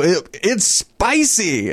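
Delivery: gasping that the bar is too spicy; mock choking shark. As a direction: squeeze it out like your mouth burns. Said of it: "and they go".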